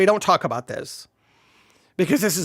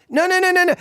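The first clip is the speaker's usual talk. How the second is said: mock frantic no's; 9 semitones higher, much faster than usual. Real, not acted. Lecturer cutting off allegorists, high teasing no's. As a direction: stop them with a teasing no no no.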